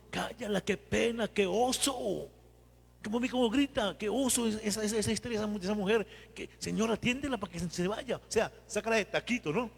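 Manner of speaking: voz aguda y burlona de discípulo fastidiado; quéjate como un discípulo harto de la mujer. Retold or imitated. (discípulo fastidiado, voz aguda y burlona)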